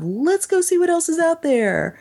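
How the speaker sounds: high-pitched quoted enthusiasm; high, sustained mock-keen quote; mimicked eagerness. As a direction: lift the voice high for the imagined explorers. Voicing the film's explorer attitude.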